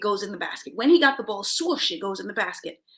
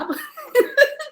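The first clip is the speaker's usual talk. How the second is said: high laugh; 5 dB louder than usual. Real, not acted. Laughs at her own joke.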